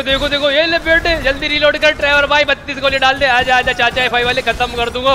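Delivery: high-energy firefight hype; excited battle taunting. Pretend. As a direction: urge yourself on while firing. Taunting enemies mid-gunfight, urging reload.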